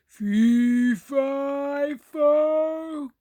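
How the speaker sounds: loud giant chant cut off; falling giant. Acted. Giant chanting as he falls, loud and booming. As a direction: boom it out as he topples.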